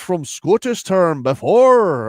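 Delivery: Scottish accent. Shown as a demonstration. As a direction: read it like a thick Scottish brogue. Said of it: "Do you have a Scottish accent".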